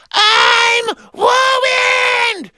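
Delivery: very high, drawn-out mock wail; kids mimicking the wail. A narrator's reading. Audience kids echoing his line, mock wailing. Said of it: "kids repeated his line".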